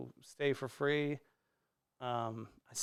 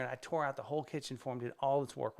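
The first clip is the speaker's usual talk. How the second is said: usual speaking voice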